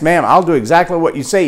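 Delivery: ordinary talk, nothing marked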